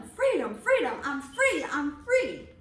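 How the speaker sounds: loud, joyful shouts of freedom; a child running through the house crying out his freedom; joyful yelling. Acted. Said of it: "ran about the house yelling".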